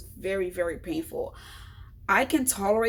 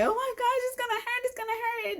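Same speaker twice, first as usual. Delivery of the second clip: high, whimpering dread of pain; mock dread. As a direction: fret about the coming hurt. Retold dread of stitches.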